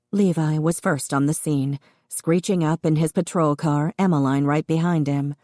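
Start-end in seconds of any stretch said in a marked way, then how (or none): none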